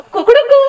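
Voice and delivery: high, loud cock-a-doodle crow; rooster crow buzzer. Shown as a demonstration. Host showing the boys' buzzer sound, loud rooster crow. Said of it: "જવાબ ખબર છે તો શું બોલવાનું".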